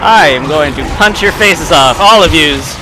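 loud playful threat. Real, not acted. Gamer threatening enemies, loud playful threat. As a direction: puff up before the punch.

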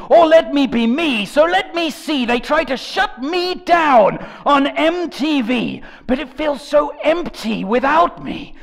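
ordinary delivery

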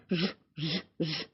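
short buzzing Ж pulses; repeated low-key "zh" buzz; imitated bee buzz. Shown as a demonstration.

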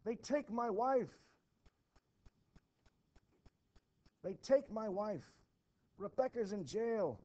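high, loud anguished outburst; a frantic husband shouting that his wife is taken; distraught cry. Acted. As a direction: cry it out in despair. Giles distraught about arrested wife.

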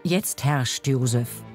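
ordinary delivery

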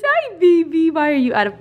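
high, cooing greeting to a pet; sweet, lifted pet-greeting voice. Real, not acted. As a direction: coo at the puppy. Greeting her dog.

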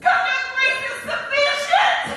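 high, loud, impassioned sermon voice; full-force preaching. Acted. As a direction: preach it to the rafters.

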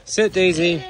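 high, loud command; calling a command. Real, not acted. Keeper telling Daisy the pig to sit, loud command. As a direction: call it out high to an animal.

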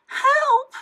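very high, loud call for help; distressed cry. A narrator's reading. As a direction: cry out in alarm, high-pitched. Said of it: "she cried".

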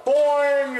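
high, slow boing through the echo; toy echo microphone. Shown as a demonstration. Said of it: "That's my echo mic".

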